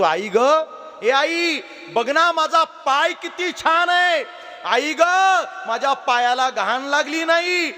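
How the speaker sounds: put-on high, eager child voice showing off to mother; proud little child. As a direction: sing it up like a delighted four-year-old. Child Shyam showing his clean foot.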